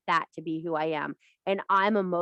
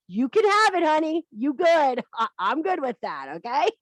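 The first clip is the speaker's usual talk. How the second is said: higher, louder sugary "you can have it, honey"; sweet mock reassurance; 7 semitones higher, 3 dB louder than usual. Backing off from a rival witch with sugary mock kindness. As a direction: play it sickly sweet, backing off.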